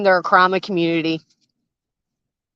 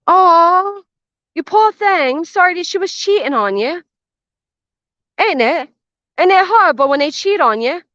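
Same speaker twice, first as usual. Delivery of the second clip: mock pity, pitched higher with a rising lilt; sarcastic sympathy; 7 semitones higher than usual. Mocking the murderer who blamed his wife, sarcastic sympathy. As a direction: coo fake pity at him.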